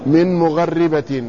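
slow مغربة as "mugharribatin", rolled double ra; doubled ra with kasra. Shown as a demonstration. Teacher repeats the scholars' reading, doubled ra with kasra. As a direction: say مغربة slowly, a after ghayn, doubled ri. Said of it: "وكسروا الراء وشددوها".

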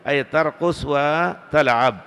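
ordinary delivery

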